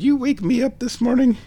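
high cooing question. Real, not acted. Vlogger questioning cat about this morning, high baby talk.

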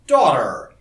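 loud, peremptory echo; mock-stern address, falling pitch; demanding. A narrator's reading. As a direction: throw the summons back at them. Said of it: "he replied in the same tone".

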